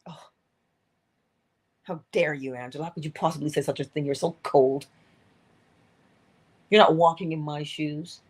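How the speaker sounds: a hammed-up hurt protest at Angela; mock offended protest. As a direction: play the wounded listener answering back. Acted indignant listener answering back.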